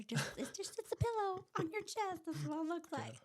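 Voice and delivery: high-pitched, laughing exasperation; comic, high-voiced insistence; amused exclamation. Real, not acted. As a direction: blurt it out through rising laughter. Joking about her chest, laughing.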